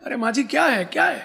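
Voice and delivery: high, urgent questioning; alarmed asking. Retold or imitated. Darbar running to the old woman, alarmed. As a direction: ask what's wrong, pitch climbing.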